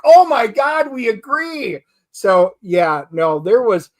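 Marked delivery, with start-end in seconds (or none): 0.0-1.8 higher and louder, delighted outburst; excited agreement. Retold or imitated. To his wife after both hated the movie, delighted. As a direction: burst out in shared relief. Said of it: "I'm like".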